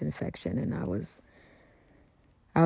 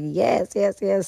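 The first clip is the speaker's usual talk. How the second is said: eager yeses, higher and louder; excited yes; 6 semitones higher, 5 dB louder, slower than usual. Retold or imitated. Singer accepting [name]'s jam invitation, excited higher and louder. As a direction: blurt it out, thrilled.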